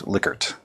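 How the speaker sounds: Likert said LICK-ert, short i; original pronunciation. Shown as a demonstration. Lecturer giving the developer's last name, LICK-ert pronunciation of Likert. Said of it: "their last name was".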